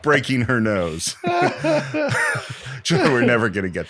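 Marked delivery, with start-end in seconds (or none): 1.2-2.8 big laugh. Real, not acted. Host laughing at joke.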